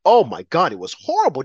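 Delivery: normal talking voice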